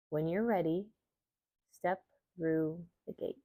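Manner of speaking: calm, measured meditation reading with long pauses; guided meditation. Acted. Yoga teacher guiding listeners through an Imbolc visualization, meditation voice. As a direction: guide listeners gently inward, leaving space between phrases.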